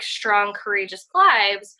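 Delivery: ordinary delivery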